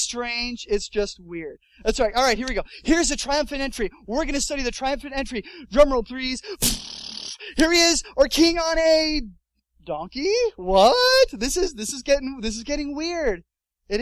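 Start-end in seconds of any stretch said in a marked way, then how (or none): 2.8-9.2 loud, high fanfare build-up; hammed-up ringmaster introduction; mock grand announcer. Mock announcing the king's entry. 9.8-11.3 puzzled, then a high loud "What"; hammed-up shock at an anticlimax; incredulous double take, voice leaping up; mock disbelief. Acted shock at the donkey.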